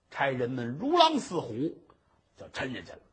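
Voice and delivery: usual speaking voice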